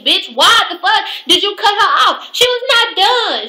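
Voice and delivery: high, indignant outburst; outraged. Real, not acted. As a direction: rant up high in disbelief. Outraged rant about interruption.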